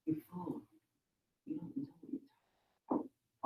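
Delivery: squeaky nagging little voice; high inner voice. Acted. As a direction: pitch it way up, half whispered. Inner voice in story read.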